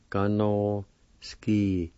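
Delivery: gá nó Ski, si, do, sti, said slowly in parts; broken down slowly. Shown as a demonstration. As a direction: take it slow and sound it out. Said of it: "You might want to say".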